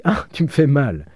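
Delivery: cri aigu de douleur, plus haut; elle crie qu'il la serre trop fort; petit cri de douleur. A narrator's reading. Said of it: "qu'elle dit". (petit cri de douleur, plus haut)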